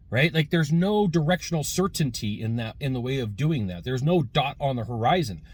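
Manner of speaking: normal talking voice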